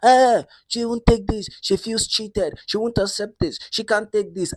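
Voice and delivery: high-pitched indignant outcry; mock shouting. Latifa complains to the manager, shouting. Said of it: "Latifa started shouting".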